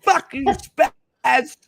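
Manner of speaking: high, very slow fuck you, Spaz; drawn-out mock insult. Friend rehearsing the joke insult for the clip, drawn-out and high. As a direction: stretch every word in a playful taunt.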